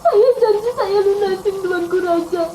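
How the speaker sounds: high, whimpering servant; acted tearful pleading. Servant begging the king not to punish him, tearful. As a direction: plead in broken, crying bursts. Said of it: "Dia nangis minta ampun".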